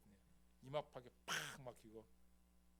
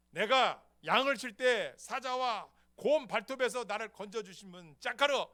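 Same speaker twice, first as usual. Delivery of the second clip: louder, higher fervent appeal; David addressing Saul; 7 semitones higher, 16 dB louder than usual. Retold or imitated. David urging Saul and Israel not to fear. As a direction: stir the frightened people. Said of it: "다윗의 그 가진 믿음이".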